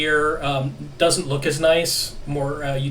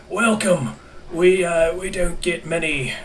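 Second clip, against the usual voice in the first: put-on raised-pitch old man greeting; old clone voice; 4 semitones higher, 3 dB louder than usual. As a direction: croak a welcome like a frail old clone.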